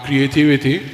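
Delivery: normal talking voice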